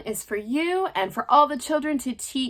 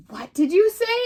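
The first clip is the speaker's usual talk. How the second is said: alarmed question; 8 semitones higher, 4 dB louder than usual. Acted. Cricket and beetle alarmed.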